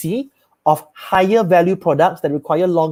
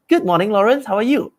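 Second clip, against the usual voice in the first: bright, lively, higher greeting; upbeat excited voice; 4 semitones higher than usual. Shown as a demonstration. Sales rep greeting Lawrence the right way, upbeat and excited. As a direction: greet him like you're glad he picked up. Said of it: "always have an upbeat, open, excited voice".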